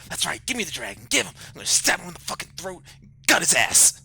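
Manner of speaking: a manic head screaming for blood; frenzied shout. Acted.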